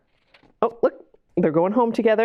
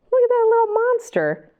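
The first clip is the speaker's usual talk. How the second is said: delighted cooing. Acted. Reader cooing at the monster on the cover. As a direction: coo over the cover.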